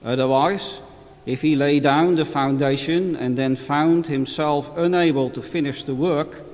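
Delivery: measured solemn scripture reading; Gospel reading. Acted. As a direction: read it like a priest at Mass. Formal Gospel reading at Mass.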